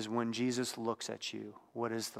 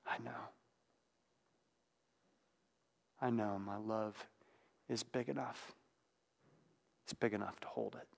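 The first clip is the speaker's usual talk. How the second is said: much softer, gentle voice fading to a whisper; tender reassurance; 5 dB quieter than usual. Acted. Jesus looking at Peter with compassion, soft tender reassurance. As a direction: soothe him softly, almost whispering at the end.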